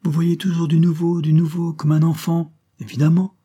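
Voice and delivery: high, loud outburst; crying out. A narrator's reading. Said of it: "cria-t-elle".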